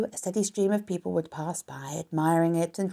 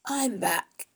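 husky, slightly raised rat voice; 5 semitones higher than usual. A narrator's reading. Templeton drags himself back from his night out, husky. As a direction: announce yourself huskily. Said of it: "he said in a husky voice".

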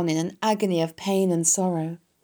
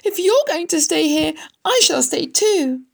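high, loud, insistent wail; distraught shout; 13 semitones higher, 6 dB louder than usual. A narrator's reading. Wilbur refuses to leave Charlotte to die, distraught shout. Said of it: "shouted Wilbur".

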